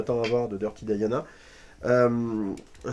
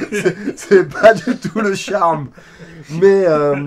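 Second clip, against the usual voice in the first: loud, high objection broken by laughter; laughing protest; 4 semitones higher, 11 dB louder, much slower than usual. Real, not acted. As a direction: disagree heartily through a laugh. Joking disagreement amid laughter.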